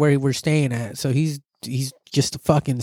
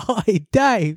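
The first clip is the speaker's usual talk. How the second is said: Australian call; 6 semitones higher than usual. Pretend. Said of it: "another Australian off in the distance".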